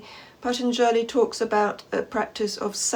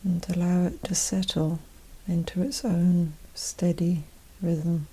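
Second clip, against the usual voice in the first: guided meditation; 2 semitones lower, 4 dB quieter than usual. Acted. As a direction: gently guide them inward, unhurried and even, leaving space after each phrase.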